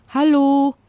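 high, loud drawn-out call. A narrator's reading. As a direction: shout a hopeful hello upward. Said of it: "rief er noch einmal".

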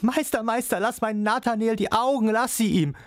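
a desperate father pleading for his son; pleading cry. A narrator's reading. Father begs Coppelius to spare his son's eyes, pleading high. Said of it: "flehend die Hände empor und rief".

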